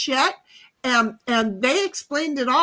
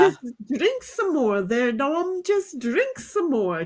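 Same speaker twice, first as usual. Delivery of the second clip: lightly put-on Irish accent, slow on the last line; a friend's Irish lilt, urging Dom to drink more; 2 semitones higher, slower than usual. Teasing friend urging Dom on, in a light Irish accent. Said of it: "[name] and her Irish accent".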